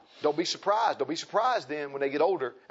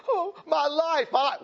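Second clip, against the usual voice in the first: mock lament; 10 semitones higher, slower than usual. Preacher mocking self-pity, slow high wail. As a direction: ham up a self-pitying moan. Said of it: "none of that stuff about".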